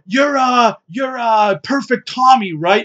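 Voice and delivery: high, eager guess at a name; excited fan. Retold or imitated. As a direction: ask like an unsure starstruck fan.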